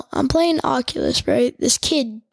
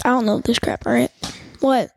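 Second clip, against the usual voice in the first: ordinary talk, nothing marked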